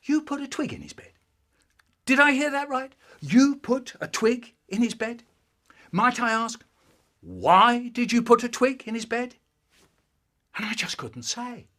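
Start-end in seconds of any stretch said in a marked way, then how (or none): 0.0-5.2 higher, louder disbelieving dad; a touch of stern fatherly disbelief; incredulous dad. Retold or imitated. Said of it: "So my dad goes". 5.9-9.4 louder, pointed fatherly question; mock-stern father interrogating; stern dad. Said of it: "So my dad goes".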